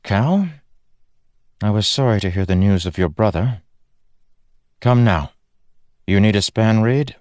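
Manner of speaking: a sharp, practical noblewoman talking down a little; brisk lady of the manor. Acted. Laurel greets Kaladin, crisp and no-nonsense.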